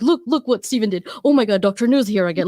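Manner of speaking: lightly hammed-up delight at a friend's success; excited. Showing colleagues Steven's win: retold excited gushing. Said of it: "I get excited".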